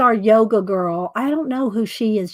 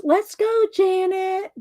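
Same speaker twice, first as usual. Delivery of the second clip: high-pitched playful doll voices; squeaky back-and-forth between two dolls; high doll banter; Janet and Arlene voices; 10 semitones higher than usual. Retold or imitated. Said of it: "Janet goes".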